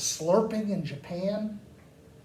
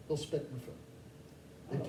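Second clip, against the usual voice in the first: whisper; 4 semitones lower, 12 dB quieter, much slower than usual. Real, not acted. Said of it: "conspiratorial aside".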